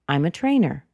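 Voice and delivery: "I'm a trainer" with TRAINER falling at the end; falling intonation. Shown as a demonstration. Answering what do you do, falling intonation. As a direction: settle downward on "trainer". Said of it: "if the intonation didn't drop too suddenly".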